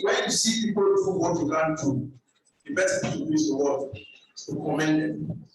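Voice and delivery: ordinary talk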